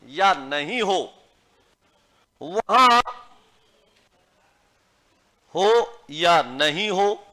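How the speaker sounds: slow, spaced rule read out, each word firm; slow dictation. Shown as a demonstration. Teacher dictating grammar rule to students, slow and firm. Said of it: "dictation of rule continued".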